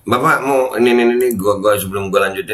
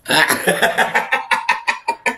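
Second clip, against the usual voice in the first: breathy laugh; 7 semitones higher than usual. Real, not acted. Laughing at the news claim.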